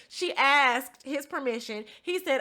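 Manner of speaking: ordinary talk, nothing marked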